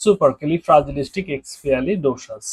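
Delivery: সুপার ক্যালি ফ্রাজিলিস্টিক এক্সপিয়ালি ডোসাস joined smoothly; full word joined. Shown as a demonstration. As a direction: put the syllables together in one go. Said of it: "এটার উচ্চারণ হচ্ছে এইটা". (পুরো শব্দটা একসাথে উচ্চারণ)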